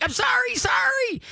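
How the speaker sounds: very high squeaky pup yelp. Retold or imitated. A scolded pup yelping its apology to mom. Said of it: "the pup yelps like".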